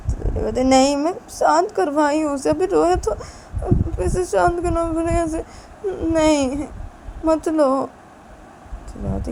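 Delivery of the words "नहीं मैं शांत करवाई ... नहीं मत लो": higher and louder, insistent protest; heated refusal. Acted. Wife loudly refuses to give the baby to husband. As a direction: refuse him flatly and loudly.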